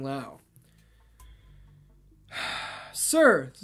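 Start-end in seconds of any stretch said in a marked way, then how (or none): 2.9-3.5 slightly raised character voice; tentative character take. Acted. Unknown follower addresses the priest, moderately raised character voice. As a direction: address him in a somewhat higher, louder voice. First try at unidentified speaker's voice.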